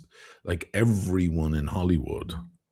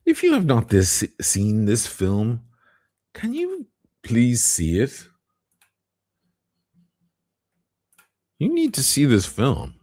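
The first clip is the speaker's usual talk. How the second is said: higher, louder, pleading insistence; earnest plea; 6 semitones higher, 4 dB louder than usual. Real, not acted. Begging viewers to watch Amadeus, insistent. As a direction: beg the viewer to go see it.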